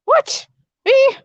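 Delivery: high, indignant what; mock surprise. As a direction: play outraged innocence, squeaky high. Accused of being sassy.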